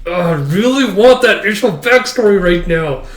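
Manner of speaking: high, loud excited craving; real eagerness. Real, not acted. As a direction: burst out with how badly you want it. Eager reaction to the chapter.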